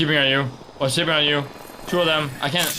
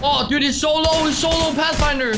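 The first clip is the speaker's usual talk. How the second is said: much higher, eager callout; excited; 10 semitones higher than usual. Real, not acted. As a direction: pounce on the chance. Enemy nearly dead.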